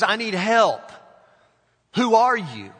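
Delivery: the hanging hiker begging the unseen voice; desperate call. A narrator's reading. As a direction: call up for help in panic. Stranded hiker calls upward.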